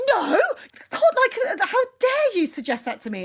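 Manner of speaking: high, loud feigned indignation; retold outrage with a laugh in it; mock shock. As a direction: act out an affronted denial. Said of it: "no, I was shocked".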